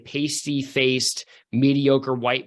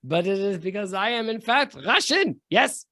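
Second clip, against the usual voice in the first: put-on higher, lightly hammy Russian accent; a hint of a Russian mercenary; 7 semitones higher than usual. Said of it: "thick Russian accent".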